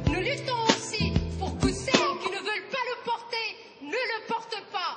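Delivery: ordinary delivery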